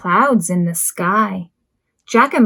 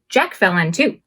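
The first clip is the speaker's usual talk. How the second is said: ordinary delivery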